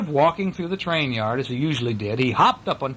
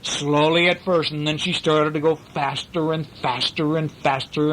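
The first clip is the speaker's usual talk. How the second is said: usual speaking voice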